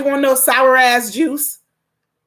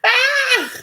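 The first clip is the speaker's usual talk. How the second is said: long, high-pitched "Ah!" after laughing; drawn-out high squeal; high cry. Real, not acted. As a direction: let out a sustained high wail of amusement.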